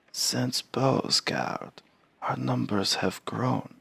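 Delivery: put-on high, slow, hushed old Varl; creaky elder giant, slow and soft. Voices old Varl character.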